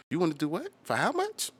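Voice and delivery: high incredulous squeak at the offer; mock outrage. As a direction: act shocked by a lowball price. Mocking a bad deal offer.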